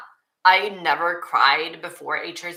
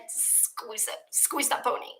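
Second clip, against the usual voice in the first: gushing whisper; 7 semitones higher, 8 dB quieter than usual. Real, not acted. Gushing over a tiny pony.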